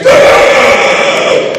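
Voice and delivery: loud breathy shocked scream; teacher's scream. Pretend. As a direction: shriek at a room of lookalikes. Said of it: "your teacher's like".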